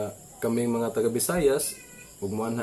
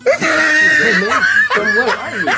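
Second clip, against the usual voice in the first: much higher and louder laughing yell; mock shout; 11 dB louder, slower than usual. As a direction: holler it like the foreigner, laughing. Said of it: "foreigner asks where from". (singgit nga nagkatawa)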